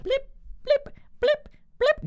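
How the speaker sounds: very high, squeaky blip sounds; cartoonish high blips; squeaky blip noises. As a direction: mimic a character bobbing up and down. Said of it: "going up and down".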